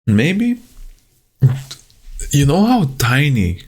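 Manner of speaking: choking up mid-sentence, really fighting tears; tearing up. Real, not acted. Vlogger suddenly tearing up while talking to camera, voice cracking high. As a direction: voice jumps high as tears come. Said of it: "I don't know why I'm tearing up right now".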